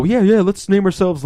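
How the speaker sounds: hammed-up group excitement; mock eager brainstorming. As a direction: imitate an over-keen team pitching ideas. Retold team eagerly proposing silly names.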